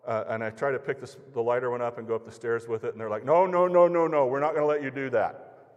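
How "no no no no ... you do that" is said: higher and louder, calming toward the end; alarmed protest. Retold or imitated. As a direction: stop him in a hurry, then settle. Homeowners stop him loudly.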